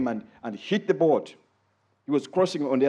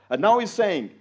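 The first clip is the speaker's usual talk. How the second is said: ordinary talk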